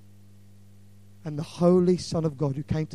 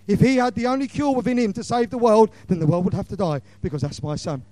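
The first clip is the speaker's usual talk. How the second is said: climbing preacher pitch; 5 semitones higher, 5 dB louder than usual. Real, not acted. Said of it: "There's me up the scale".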